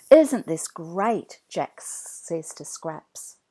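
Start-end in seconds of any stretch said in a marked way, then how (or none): 0.1-1.4 louder, slow, thrilled question; delighted boy. A narrator's reading. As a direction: savour the wonder out loud. Jack delighted in cave.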